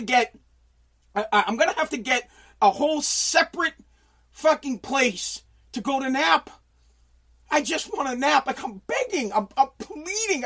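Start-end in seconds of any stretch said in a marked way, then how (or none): none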